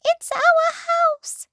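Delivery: very high, loud, slow squeak; squeaky mouse voice. Acted. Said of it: "squeaked the mother mouse".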